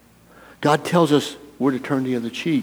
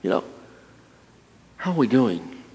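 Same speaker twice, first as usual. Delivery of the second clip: ordinary talk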